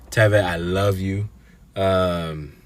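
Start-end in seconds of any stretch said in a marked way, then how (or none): 0.1-1.3 heartfelt emphatic shout-out to Teve; emphatic I love you. Real, not acted. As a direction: lean hard into love, a touch louder. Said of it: "it sounded emphatic".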